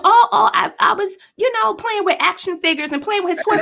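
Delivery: a mother imitating her small son's lame excuse. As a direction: play the kid caught out, mumbling an excuse. Mom voices son's excuse.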